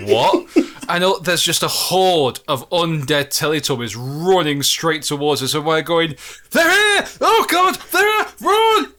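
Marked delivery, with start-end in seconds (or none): 0.1-0.5 high, loud incredulous yelp; startled disbelief. Retold or imitated. Player hearing Teletubbies in the distance, startled disbelief. Retold shock at the Teletubby horde. 6.5-8.9 very high, loud screams of alarm; acted-out panic, yelling a warning to the team; panicked shouting. Players seeing undead Teletubbies running at them, panicked shouting. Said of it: "as we're panicking".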